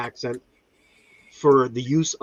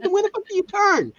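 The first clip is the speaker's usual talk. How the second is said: exasperated; 9 semitones higher than usual. Retold or imitated. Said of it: "I'm like".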